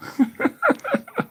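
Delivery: squeaky laugh; high squeal of laughter. Real, not acted. Laugh alone.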